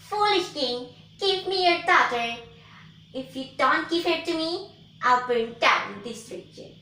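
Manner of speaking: loud, high menacing witch. Acted. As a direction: threaten like a wicked witch. Witch threatens the king.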